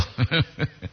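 soft trailing giggle; breathy chuckle; laughter. Real, not acted. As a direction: dissolve into a quiet laugh. Amused by the stumbled word.

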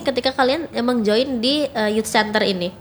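ordinary talk

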